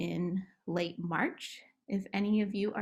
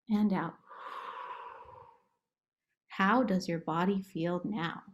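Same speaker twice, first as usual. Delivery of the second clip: guided relaxation. Acted. Therapist guiding kids through monster relaxation, calm playful. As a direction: coax children through tensing and relaxing, gently.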